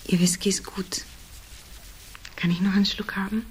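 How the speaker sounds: faint breathy whisper, barely any voice; weak whisper. Acted. Dazed woman whispers to the man who found her, in a faint whisper. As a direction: whisper it, still dazed from the blow.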